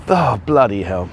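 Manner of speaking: low, pained oath after bumping into something; pained exclamation. Real, not acted. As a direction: swear under your breath from a sudden knock. Walked into a prong.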